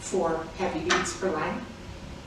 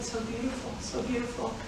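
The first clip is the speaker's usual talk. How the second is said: soft awe; 2 semitones higher, 9 dB quieter than usual. Real, not acted. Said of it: "repeated dreamy phrase".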